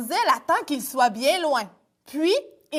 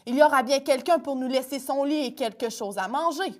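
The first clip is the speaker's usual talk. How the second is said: ordinary delivery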